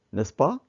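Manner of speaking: "n'est-ce pas" lifting sharply on "pas"; questioning tag. Shown as a demonstration.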